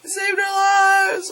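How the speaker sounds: high-pitched, drawn-out cheer; hammed-up thankful call; mock grateful shout. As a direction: yell after a departing hero. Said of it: "you're kinda like".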